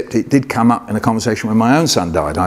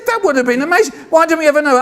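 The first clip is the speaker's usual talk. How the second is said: very high, excited complaint; mock youthful protest; faster than usual. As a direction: plead upward like a thwarted youngster. Acting young self's protest to dad.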